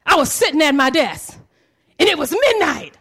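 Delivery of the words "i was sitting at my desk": ordinary delivery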